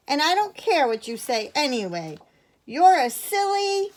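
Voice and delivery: high, loud cheeky insult; defiant retort. A narrator's reading. Edith insulting mister bear, high and loud. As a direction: hurl it with a pout. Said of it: "replied Edith".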